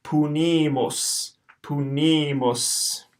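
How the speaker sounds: slow, long U in Punimus, short U at end; Latin word demo. Shown as a demonstration. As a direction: model Punimus carefully. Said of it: "first syllable will be pu".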